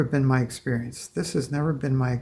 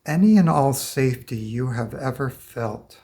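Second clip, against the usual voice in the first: guided meditation. Acted. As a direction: invite them gently, one step at a time. Guides client through release.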